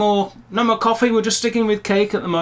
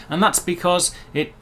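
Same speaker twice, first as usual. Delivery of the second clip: normal talking voice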